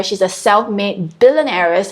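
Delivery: ordinary talk, nothing marked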